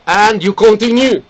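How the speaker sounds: ordinary talk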